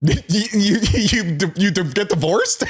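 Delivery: high question through laughter; laughing. Real, not acted. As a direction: laugh out the words. Laughing at the joke.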